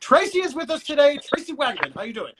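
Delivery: upbeat radio announcer read, building in pitch; radio host intro. Acted. Host opens radio portion introducing special guest.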